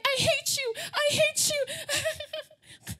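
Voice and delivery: high-pitched mock tantrum, dragged out; upset teen outburst. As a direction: melodramatic TV teenager yelling at a parent, breaking into laughter. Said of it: "whenever somebody is upset".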